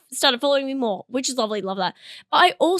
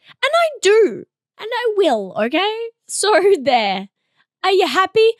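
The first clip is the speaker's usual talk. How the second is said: loud, high, defiant insistence; comically forceful claim of being happy; shouting happiness; 7 semitones higher, 6 dB louder than usual. Pretend. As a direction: protest far too much, nearly shouting. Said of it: "shouting, "I'm happy," into a camera".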